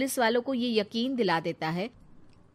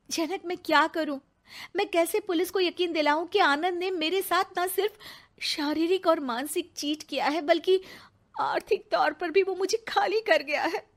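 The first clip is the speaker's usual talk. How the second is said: higher-pitched, acted desperate appeal; distressed plea; 6 semitones higher than usual. Cheated woman begging her lawyer friend for advice, distressed. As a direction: plead with rising distress for help.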